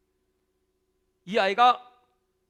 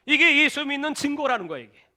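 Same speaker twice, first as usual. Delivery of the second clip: normal talking voice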